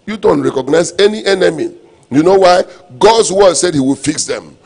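ordinary talk, nothing marked

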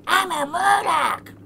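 very high, loud gargled creature cry; Murloc. Pretend. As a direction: stretch it out as a weird creature noise. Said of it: "how to make such a weird sound".

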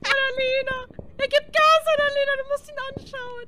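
high loud urgent calls; urgent shouting. Real, not acted. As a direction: shout instructions at a teammate. Ghost hunting teammate.

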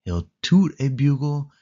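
ordinary delivery, nothing marked

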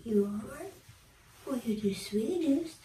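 high, soft cooing to a pet. Real, not acted.

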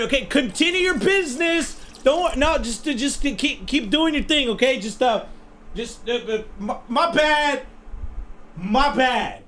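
high, softened, stammering apology; awkward backing away. Acted. Intruder backing out of the bathroom, sheepish and embarrassed. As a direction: tiptoe out with a small, high voice.

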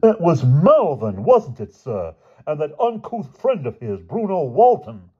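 high, slow affected accusation; indignant George. Acted. Boy blaming roommates to the headmaster, high affected indignation. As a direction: draw it out in outraged upper-crust style. George excitedly names the culprits.

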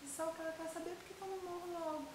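very soft, high-pitched complaint; wounded, self-pitying aside; hurt lament. Acted. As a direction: grumble the hurt to yourself, thin and quiet. Mother laments daughter's insult.

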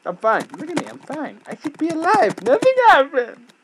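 high, loud insistence; mock denial. Real, not acted. Gamer pretending his dead character is fine. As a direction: insist nothing happened, worked up.

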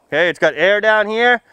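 ordinary talk

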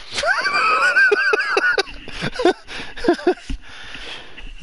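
very high screaming laugh; shrieking laugh. Real, not acted. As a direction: burst into a shrill screaming laugh. Shrieking laugh after joke.

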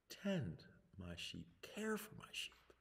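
soft, slow, hushed command; gentle voice of Jesus. Acted. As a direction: speak it tenderly, almost a whisper. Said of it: "in that voice that only Jesus can speak".

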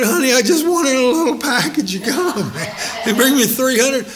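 high, laughing protest; amused husband protesting to his wife; laughing disbelief. Retold or imitated. Retelling disbelief at bucket.

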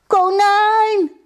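very high, loud desperate call. Acted. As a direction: cry out for a lost friend, high and drawn out. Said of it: "riep het hondje". (luid en hoog roepend)

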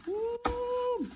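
high gleeful ooh stretched out; a long, high, happy ooh; delighted ooh. Real, not acted. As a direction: squeal with glee at the gloves. Delighted while mixing the meat.

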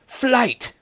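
very high, strained single word; frightened utterance. A narrator's reading. Rat answering his chief's question, terrified. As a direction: squeeze the word out in fear. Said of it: "pallid lips barely formed the word".

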